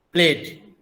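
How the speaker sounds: "प्लेड" ending in a voiced d; word alone, "प्लेड" closed on d; d-ending demonstration. Shown as a demonstration. Teacher giving an example of the d ending, pronunciation demonstration. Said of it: "as a end sound".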